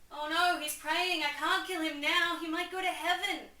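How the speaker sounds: high, loud, hammed-up fretting; mock dismay. Hamlet finding Claudius praying, in mock dismay. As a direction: whine it out like a melodramatic prince.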